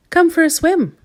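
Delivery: high, loud call; cried out. A narrator's reading. Mister Small calling Mister Tall to swim, high and loud. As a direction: call him into the water eagerly. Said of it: "cried Mister Small".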